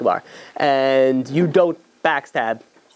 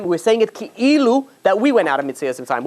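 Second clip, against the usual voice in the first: normal talking voice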